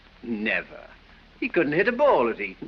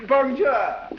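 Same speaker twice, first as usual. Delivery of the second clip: higher, louder, hammed-up French bonjour; mock French greeting; 9 semitones higher, 3 dB louder than usual. [name] mocks the French ambassador, hammed-up French Bonjour. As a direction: toss off "Bonjour" in an exaggerated French lilt, pitched up. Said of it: "as the French say".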